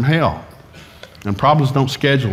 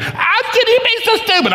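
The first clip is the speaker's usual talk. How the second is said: very high squealed mock yell; hammed-up screechy scolding; helpless angry outburst. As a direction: let the rage burst out mid-prayer, pitched extremely high. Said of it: "I'm trying to pray in tongues".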